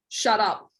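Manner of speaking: ordinary delivery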